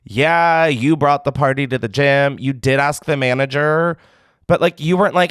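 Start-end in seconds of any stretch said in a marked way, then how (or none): none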